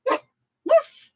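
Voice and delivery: high-pitched little woof; playful high "woof"; imitated dog bark. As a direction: mimic a small dog's bark.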